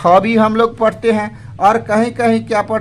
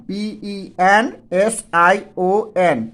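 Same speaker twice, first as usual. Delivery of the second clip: slow spelling out; much slower than usual. Shown as a demonstration. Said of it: "इसको हम लोग क्या पढ़ते हैं".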